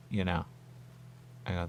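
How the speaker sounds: ordinary talk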